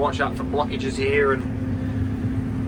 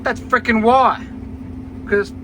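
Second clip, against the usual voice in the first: frustrated outburst; 8 semitones higher than usual. Real, not acted. Frustrated outburst about visibility.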